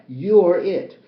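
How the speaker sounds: fervent spiritual preaching; impassioned sermon voice. Acted. Ecstatic chant of names.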